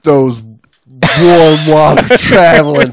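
loud, slow mocking jab. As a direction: sneer at the pansies who fly south. Teasing warm-water divers.

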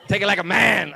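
hammed-up shouted command; mock stern rebuke. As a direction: belt it out like a scolding wife. Wife's rebuke retold as a shout.

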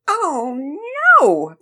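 drawn-out, loud cry of dismay; dismayed wail. A narrator's reading. Young pitcher to herself, dismayed wail. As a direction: wail it as the pitch goes wrong.

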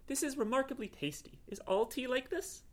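high-pitched, wondering cat voice; pleasant surprise. Acted. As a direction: marvel at a first taste. Cat marvels at first tea.

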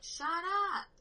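high mock shout at the drunks; retold yell. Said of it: "I'm yelling at them".

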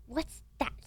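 mildly indignant villain; a touch of spat-out disbelief; Malfoy spitting. A narrator's reading. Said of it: "spat Mister Malfoy".

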